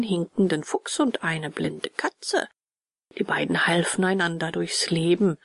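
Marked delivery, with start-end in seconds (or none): none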